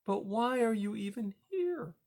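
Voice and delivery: put-on girl puzzled to find her friend here. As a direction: ask with real bafflement. Poppy's voice.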